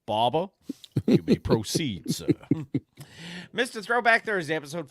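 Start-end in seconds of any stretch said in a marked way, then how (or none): 0.1-2.3 lightly voiced, louder Tombstone impression; a hint of a gruff cowboy. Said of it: "You sound, sounded like Tombstone".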